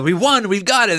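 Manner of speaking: mildly excited, as if victory is secured; voiced triumph. Heroes pulling off Thanos's glove, voiced triumph. Voicing heroes thinking they have won.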